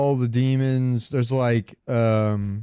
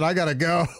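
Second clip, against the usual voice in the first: imitating panic; 4 semitones higher than usual. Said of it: "like you said".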